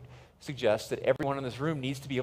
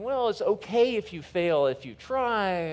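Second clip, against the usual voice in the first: high, loud singsong of easy comfort; mock reassurance; 7 semitones higher, 5 dB louder, slower than usual. Speaker quoting the attitude that it's okay to fail, mock-reassuring. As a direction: draw out "if you try" like an indulgent platitude.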